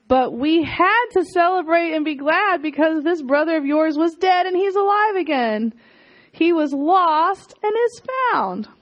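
loving father pleading; tender father. Acted. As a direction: coax the son kindly.

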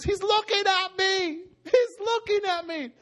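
very high, amazed cry; acted girl's astonished squeal; overwhelmed excitement. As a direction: exclaim it high, almost crying with wonder. Acts out girl thrilled by vision.

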